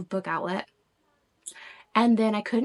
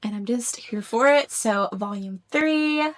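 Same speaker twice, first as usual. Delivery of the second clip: normal talking voice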